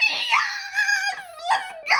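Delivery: very high squeal of triumph; ecstatic shriek. Real, not acted. Fan finally getting the wanted card, ecstatic high shriek. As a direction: shriek it in joyful victory.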